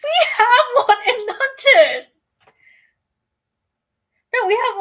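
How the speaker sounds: excited. Real, not acted. Excited outburst.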